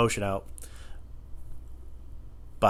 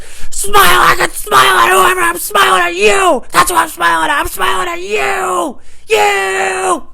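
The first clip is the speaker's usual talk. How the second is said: very high, loud, stretched shrieking; unhinged screaming; 13 dB louder, slower than usual. Retold or imitated. Employee screaming into the customer's face, unhinged fury. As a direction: re-enact total rage, screaming every word. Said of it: "I was screaming my ass off".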